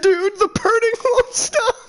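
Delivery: high, whiny cry of pain; mock pained whine. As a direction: whimper like it burns, hammed up. Friend voiced in pain after bleach.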